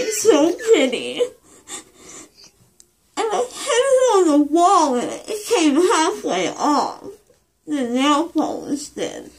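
tearful, wobbly high voice; crying. Real, not acted. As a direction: weep through the words. Weepy post-op over nails.

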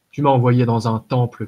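loud, angry accusation; narrated warrior's cry; shouted. A narrator's reading. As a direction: raise your voice in outrage. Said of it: "cria-t-il".

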